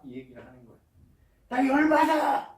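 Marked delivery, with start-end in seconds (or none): 1.5-2.6 sudden loud, high-pitched mock yell; angry outburst. Preacher voices young Elihu fuming at Job's friends, angry shout. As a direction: blow up, acting out Elihu's fury.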